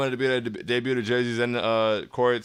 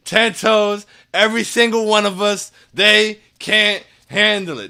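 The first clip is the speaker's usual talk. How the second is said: loud, higher hype chant, slowing on the last line; hyped up; 7 semitones higher, 6 dB louder, slower than usual. Shown as a demonstration. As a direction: pump the crowd up with every word. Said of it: "That was much better. I felt a lot better about that one".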